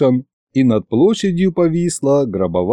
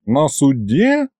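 ordinary delivery

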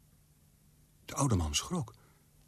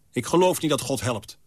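louder, cutting and bitter; biting; 5 semitones higher, 6 dB louder than usual. A narrator's reading. Old man snapping bitterly at his visitor Azriel. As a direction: bite off the words at the visitor. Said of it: "bijtend toe te voegen".

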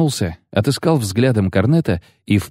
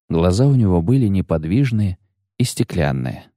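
ordinary delivery, nothing marked

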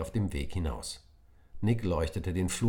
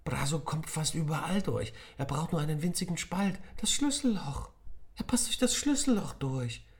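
higher, exasperated self-scolding; annoyed at himself; 12 semitones higher than usual. Acted. Nick realises the keyhole, cross with himself. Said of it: "sich über sich selbst zu ärgern".

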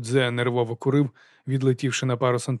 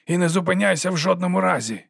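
higher, urgent shouted order; 6 semitones higher than usual. A narrator's reading. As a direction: call it after a running boy. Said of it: "крикнув Лудзе".

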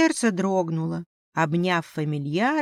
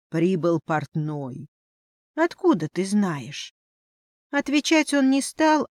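usual speaking voice

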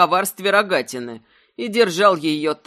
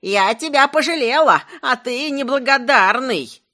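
put-on loud, high old-crone squeal; shrieking old woman; 6 semitones higher, 4 dB louder than usual. A narrator's reading. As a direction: shriek in frantic protest. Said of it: "истошно взвизгнула рогатина каким-то старушечьим голосом". (истошный старушечий визг)

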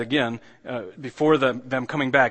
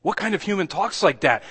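higher, emphatic incredulous question; 6 semitones higher than usual. Real, not acted. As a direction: put the question out in open astonishment. Repeats the question, now astonished.

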